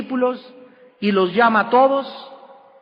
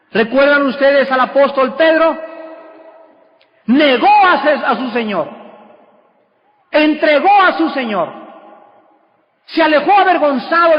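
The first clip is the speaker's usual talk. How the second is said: emphatic preaching; 5 semitones higher, 4 dB louder than usual. Acted. Preacher stressing Peter's denial.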